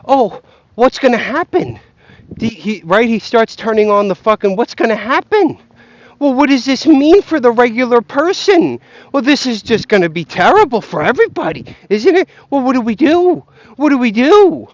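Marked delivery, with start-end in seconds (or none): 0.1-1.8 high, loud whiny worry; mock the frightened interviewer; a hammed-up panicky host. 4.6-14.7 higher, louder whining panic; mock doom-monger wailing. Mocking panicky interviewer.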